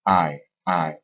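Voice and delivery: "I" gliding from A to E; "I" as one blended ah-ee sound, repeated; diphthong demonstration. Shown as a demonstration. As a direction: draw out "I" as a slide from ah into ee. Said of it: "On commence sur une certaine voyelle".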